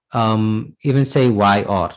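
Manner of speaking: ordinary delivery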